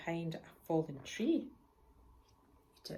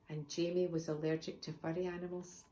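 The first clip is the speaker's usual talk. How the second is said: usual speaking voice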